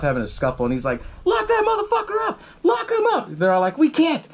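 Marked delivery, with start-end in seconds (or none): 1.2-3.3 high-pitched yelled order; mock furious yell. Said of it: "getting all pissed off". 3.8-4.3 exasperated protest. Retold or imitated. Acted protest to angry sheriff.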